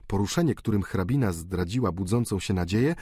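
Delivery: ordinary delivery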